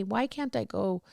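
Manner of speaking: normal talking voice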